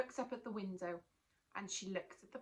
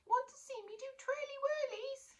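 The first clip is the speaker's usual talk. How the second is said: very high, proud squeak; tiny monster. Acted. Tiny monster offering Natalie a trick, proud high squeak. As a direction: show off like a tiny creature.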